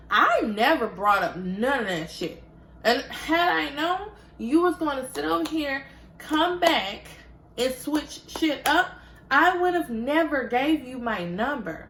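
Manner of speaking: furious at being played; heated rant. Retelling her angry rant.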